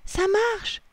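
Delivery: very high happy squeal; joyful cry. A narrator's reading. Lily sees the plan working, joyful cry. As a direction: cry out in delight. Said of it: "s'écrie-t-elle joyeusement".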